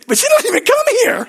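very high, loud, fast mock protest; hammed-up high-pitched objection; exasperated wail. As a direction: whine it loudly in comic frustration. Repeats objection to God, exasperated.

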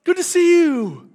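loud, high, slow delighted greeting; joyful welcome. Shown as a demonstration. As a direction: welcome him like a dear old friend. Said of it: "So not just B J".

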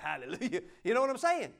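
ordinary talk

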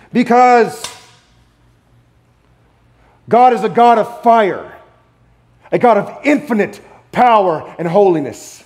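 loud, high proclamation; loud firm declaration; emphatic preaching. Real, not acted. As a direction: preach it out with force. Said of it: "preaching at full force".

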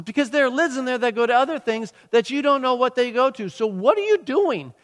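high-pitched mock scolding; scolding wife. Wife telling husband not to toss the Tupperware, scolding. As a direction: imitate an exasperated wife setting him straight.

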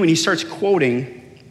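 usual speaking voice

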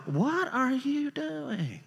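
high, soft, drawn-out question; mock bewilderment. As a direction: stretch it out slowly in a high put-on voice. Mock question to the grudge holder.

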